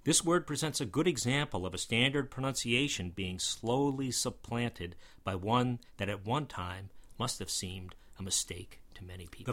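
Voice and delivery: ordinary delivery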